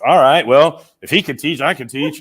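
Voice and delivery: a loud, high, playful dare to himself; cocky self-quote. Retold or imitated. Recalling deciding to teach, loud confident bravado. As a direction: throw it out like a challenge you've already won. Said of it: "I'm like".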